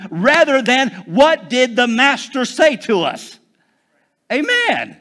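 fiery Pentecostal preaching shout; full-force preaching. Acted. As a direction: preach it hard, pushing the congregation up. Preaching climax, rising to shout.

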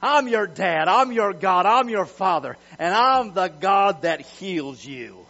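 loud, booming, confident proclamation; bold swagger. Acted. Preacher voicing God declaring Himself to His people, loud with swagger. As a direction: call out and declare it with full authority. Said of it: "He said it with a bunch of swagger".

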